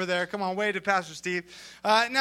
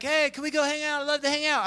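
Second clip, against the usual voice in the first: higher, put-on friendly voice; mock over-nice; 5 semitones higher, faster than usual. Imitating someone angling to hang out, higher and over-nice. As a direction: play the eager would-be pal. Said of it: "they're being really nice".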